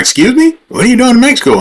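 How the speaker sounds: high-pitched, affronted echo; indignant. Retold or imitated. Driver answering a nosy border officer, indignant. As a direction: repeat it back in offended disbelief. Acting out his indignant comeback.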